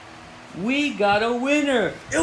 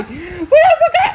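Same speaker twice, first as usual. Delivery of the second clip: much higher, fast voice, laughing; laughing squeak; 13 semitones higher, 5 dB louder than usual. Acted. Laughing mock voice.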